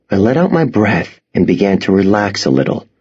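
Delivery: ordinary delivery, nothing marked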